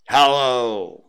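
loud, gruff mock-stern greeting; feigned growl. Scrooge feigns his old self to his clerk, growling. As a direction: growl it loudly as a grumpy boss. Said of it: "growled Scrooge in its accustomed voice, as near as he could feign it".

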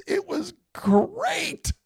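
delighted. Real, not acted. Crowd saves cat.

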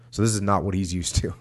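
plain reading voice

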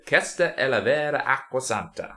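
put-on Italian line with a real Italian accent. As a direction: speak it like a native Italian. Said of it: "That sounded quite good".

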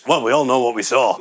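ordinary talk, nothing marked

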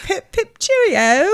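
high, hammed-up English caricature; mock English accent. Co-host joins in imitating teasing friends, mock English accent. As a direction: pipe it up in a silly English accent. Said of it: "got an annoyingly English accent back".

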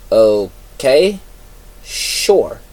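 drawn-out, unsure okay with a pitch lift; hesitant, puzzled agreement; confused. A narrator's reading. Shinzo answers Mamedo's odd instructions, confused.

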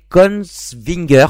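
Kongsvinger said slowly and carefully in a Norwegian accent; Norwegian accent attempt. Shown as a demonstration. Host tries the Norwegian town name for listeners, slow careful Norwegian accent. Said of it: "pour mon accent, euh, norvégien".

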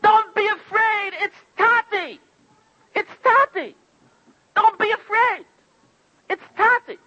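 put-on calm the frightened little boy; mother reassuring. Mother soothing her crying son, gently reassuring. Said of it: "You know what Mommy tells him?".

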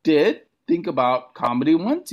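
high, bashful little voice; sheepish. Retold or imitated. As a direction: admit it like a shy kid. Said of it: "I'm like".

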